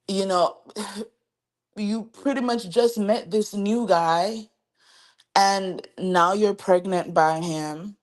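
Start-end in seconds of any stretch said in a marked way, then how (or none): none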